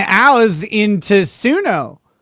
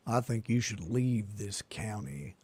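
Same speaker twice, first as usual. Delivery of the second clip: imitating Sam Elliott; 12 semitones lower, 20 dB quieter than usual. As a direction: lower your voice and play the stern lawyer. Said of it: "Attorney Sam Elliott over there".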